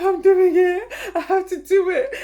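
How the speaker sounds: high, loud half-laughing shout; triumphant cry. Retold or imitated. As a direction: cheer yourself on across the bridge. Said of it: "I'm like".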